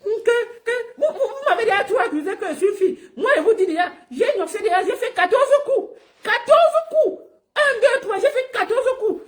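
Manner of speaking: mocking, squealed high impression; thin high voice. Mocking a rival accused of being a girl, thin high voice. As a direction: mimic his too-thin, high-pitched voice. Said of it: "C'est pour ça que c'est fine".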